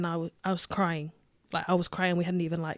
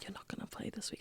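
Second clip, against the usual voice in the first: coach's whisper; 20 dB quieter, faster than usual. Retold or imitated. Coach pulls her aside quietly.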